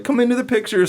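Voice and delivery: high, loud imitation of happy strangers; excited invitation. Voicing a newly engaged couple inviting a passerby, excited. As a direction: call them over in a high, excited voice.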